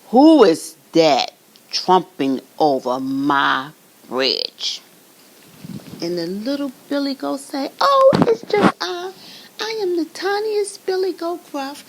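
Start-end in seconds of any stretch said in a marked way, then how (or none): 0.1-4.8 loud, slow troll growl. Acted. Troll barking at the goat on his bridge, loud and slow. 7.8-11.8 much higher, squeaky little goat; tiny goat voice. Acted. Tiniest goat answering the troll, high and timid.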